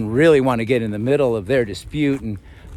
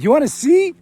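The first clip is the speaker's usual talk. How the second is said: cheeky tease. Retold or imitated. Cheeky retort to the woman.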